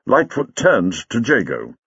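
plain reading voice

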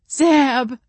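loud, high wailing cry of a name; plaintive wail. A narrator's reading. Tearful werewolf crying out her fiancé's name, loud plaintive wail. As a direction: wail it out like a heartbroken bride. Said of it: "she wailed plaintively".

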